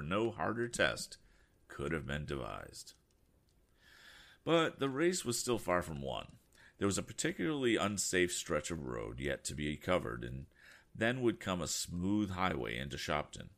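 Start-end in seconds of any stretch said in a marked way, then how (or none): none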